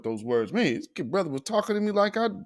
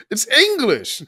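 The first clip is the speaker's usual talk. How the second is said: louder, firm "It's English" on a falling tune; insistent retort; 7 semitones higher, 6 dB louder than usual. Retold or imitated. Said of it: "what language is that?".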